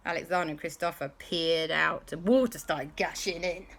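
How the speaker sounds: plain reading voice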